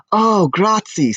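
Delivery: high, slow, delighted "Oh, grazie"; a simple lad's happy thanks; eager Anthony. A narrator's reading.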